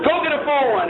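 1 mock drill order.

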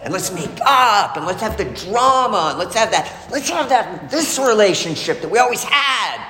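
hammed-up wife pushing for the next blowup; a mock dramatic wife calling for chaos and making up; mock crazy wife. Voicing the wife's craving for drama.

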